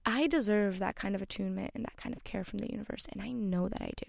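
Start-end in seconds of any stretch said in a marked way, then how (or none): 3.1-4.1 hushed, emotional whisper of self-belief; breathy emotional murmur to herself. Real, not acted. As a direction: whisper it as though holding back tears. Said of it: "I'm getting emotional".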